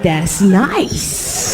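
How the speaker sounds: lightly mimicked boyfriend, approving; Puerto Rican boyfriend. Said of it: "'Cause Puerto Rican men".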